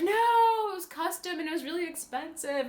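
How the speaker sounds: high-pitched imitated wail of protest; mock dismay. He learns his bear head was thrown away, mock dismay. Acts out protest at lost costume.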